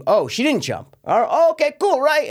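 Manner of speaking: high, sing-song sarcastic relief; mock relief. Mocking the film's twist.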